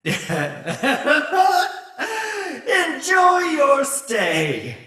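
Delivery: high, cackling villainous send-off; gleeful villain. Acted. Villain to trespassers entering the manor, gleefully menacing. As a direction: relish the threat through laughter.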